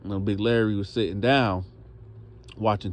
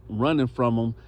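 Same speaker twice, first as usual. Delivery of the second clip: plain reading voice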